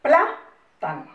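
Plátano with the first A punched and drawn out slowly; slow, emphatic Plátano for the tilde; stress on plá. Shown as a demonstration. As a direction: hit PLÁ in Plátano to show the accent. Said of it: "escuchen la pronunciación".

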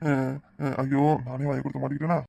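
a soft laugh at having been caught out himself. Acted. Caught out by the boy.

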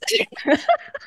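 high laugh. Real, not acted. Laughing after her accent attempt.

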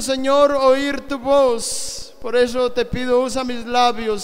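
normal talking voice

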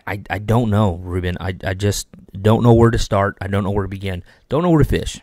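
normal talking voice

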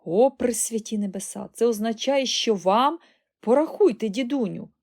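loud, high, urgent outburst; amazed excitement. A narrator's reading. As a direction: urge grandpa on excitedly. Boy amazed, urging grandpa to count.

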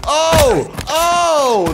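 normal talking voice

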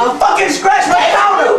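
loud, high accusing shout; bellowed at the culprit; angry accusation. Acted. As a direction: yell the accusation in outrage. Accuses man of damaging counter.

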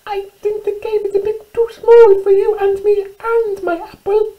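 very high, squeaky mouse voice; squeaking little mouse. Acted. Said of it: "squeaked Little Mouse".

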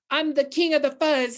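a hint of a snarling bully; snarled king boast. A narrator's reading. Teacher reading Big Bark boasting to the town, lightly snarled. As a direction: snarl it out like a bragging prairie dog. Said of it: "he snarled".

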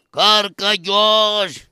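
name stretched into a very slow wail; long hammed-up grieving call; mourning cry. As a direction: call out to the dead, dragging out the name. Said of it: "Τι φωνάζεις, με ξεκούφανες".

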